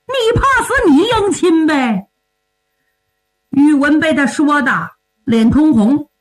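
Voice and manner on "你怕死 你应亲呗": high-pitched blunt jeer; taunting retort. Acted. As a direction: throw it back at him, high and scornful. Hothead taunts old man, refusing marriage. (高声顶撞，挖苦对方)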